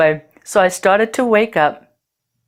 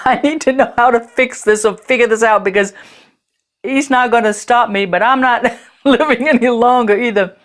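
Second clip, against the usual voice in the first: laughing; 4 semitones higher than usual. Retold or imitated. Laughing while recounting the bargaining.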